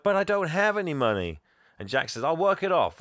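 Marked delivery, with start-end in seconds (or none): 0.0-1.4 high, loud mock whine; hammed-up retold objection; protesting boy. Acts out the boy's protest. 2.2-3.0 higher, louder retold offer; pitched-up enthusiastic bargain; eager boy. Voices Jack's eager offer.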